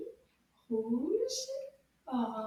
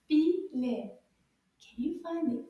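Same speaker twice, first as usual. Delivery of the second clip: usual speaking voice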